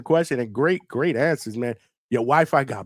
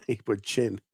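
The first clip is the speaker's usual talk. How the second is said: chuckling through the words; laughing; 3 semitones lower, 4 dB quieter, slower than usual. Real, not acted. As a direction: say it while laughing. Laughing at the question.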